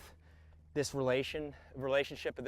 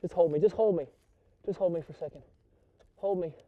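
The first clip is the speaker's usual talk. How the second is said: overwhelmed; 4 semitones higher, 6 dB louder, faster than usual. Real, not acted. Overwhelmed angler after big fish.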